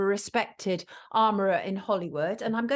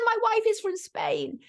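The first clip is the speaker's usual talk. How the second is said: much higher-pitched mock Spanish accent; imitated Spanish accent; 13 semitones higher than usual. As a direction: impersonate a Spanish wife, pitched right up. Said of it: "he impersonated her".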